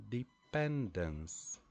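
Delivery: "dependence" slowly, light de, strong PEN, final dance; stress on second syllable. Shown as a demonstration. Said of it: "we have the stress syllable".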